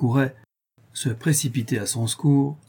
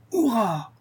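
triumphant cheer; 11 semitones higher than usual. A narrator's reading. Bandits driving off with their comrade, triumphant cheer. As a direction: send up a falling cheer.

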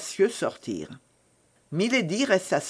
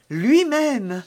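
high, louder, falling confirmation; emphatic confirmation; 9 semitones higher, 5 dB louder than usual. A narrator's reading. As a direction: confirm it proudly, pitched well up. Young woman confirms it proudly.

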